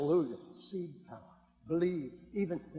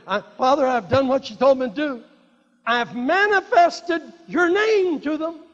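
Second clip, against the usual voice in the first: fervent prayer; 10 semitones higher, 11 dB louder than usual. Acted. Jesus praying to the Father, loud and fervent.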